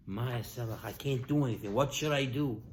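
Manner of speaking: low, soft, resigned voice; quiet helpless reply. Retold or imitated. Elisha telling the widow he can do nothing, low and quiet. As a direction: answer softly, at a loss.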